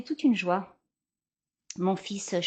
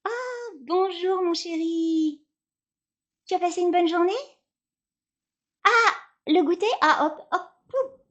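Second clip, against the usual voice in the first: sweet mother voice; 8 semitones higher than usual. Acted. Mother greets son at school.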